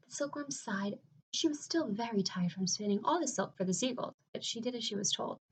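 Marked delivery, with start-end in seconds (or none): none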